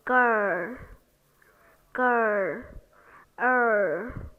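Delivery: drawn-out grr, er; long er sound. Shown as a demonstration. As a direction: hold the grr and er long and slow. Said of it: "as in".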